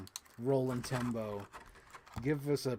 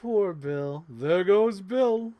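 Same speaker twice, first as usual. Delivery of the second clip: character voice; 6 semitones higher, 10 dB louder, much slower than usual. Acted. Voicing Alice figure scene.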